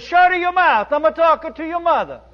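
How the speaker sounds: put-on high, loud, fast old-nun snap; nun's retort. Nun snaps up at painter on the scaffold, loud and high. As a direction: scold like a fierce little nun. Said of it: "She looked up and said".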